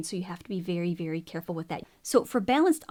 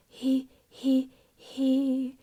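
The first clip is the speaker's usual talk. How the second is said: airy, breathy he; 4 semitones higher, much slower than usual. Shown as a demonstration. Vocal coach demonstrating too breathy. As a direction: let air leak before the vowel. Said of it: "if we're too breathy".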